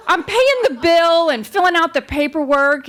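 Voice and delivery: ordinary talk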